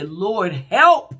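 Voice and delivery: sudden loud plea on help; desperate cry of Lord help. Shown as a demonstration. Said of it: "just need to open up your mouth and say".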